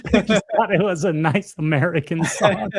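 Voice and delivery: laughing quote. Retold or imitated. Retelling dad's joke about the American song, laughing.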